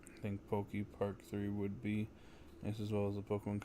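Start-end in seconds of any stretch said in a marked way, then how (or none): none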